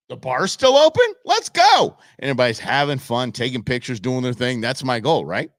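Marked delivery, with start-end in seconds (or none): 0.1-2.0 high, loud delighted outburst; thrilled banquet guest. Retold or imitated. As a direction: cheer as if free to hit the bar. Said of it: "look at the program and go".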